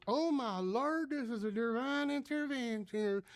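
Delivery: high, slow exclamation of wonder; amazed older woman. Retold or imitated. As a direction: imitate a lady proclaiming a miracle. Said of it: "she puts her hands up".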